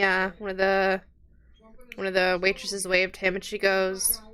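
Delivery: ordinary talk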